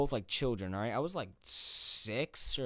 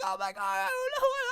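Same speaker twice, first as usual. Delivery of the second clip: panicked reply; 3 dB louder than usual. Retold or imitated.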